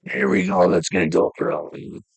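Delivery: low guttural grunt; deep guttural voice. Retold or imitated. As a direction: growl it from the gut. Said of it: "Male voice for the, uh, Team Yell".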